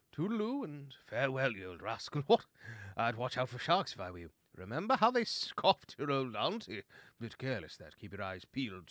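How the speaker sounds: put-on old-fashioned jolly-good-fellow voice; jolly gentlemanly chum. Bo bids the sea lion farewell, jolly and chatty. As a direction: chatter away with animated, swooping goodbyes.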